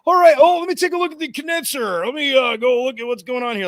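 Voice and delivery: high, breezy cover-up voice; overdone casual announcement; feigned nonchalance. Retold or imitated. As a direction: act as if nothing happened, pitched high. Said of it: "as if nothing happened".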